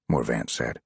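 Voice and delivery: usual speaking voice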